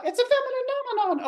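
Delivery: very high, tiny puppet voice. Acted. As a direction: make the puppet pipe up way up high. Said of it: "My puppet, he stayed up past his bedtime 'cause he wanted to say what?".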